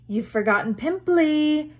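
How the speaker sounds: sly hiss. A narrator's reading. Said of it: "Peeves hissed in her ear".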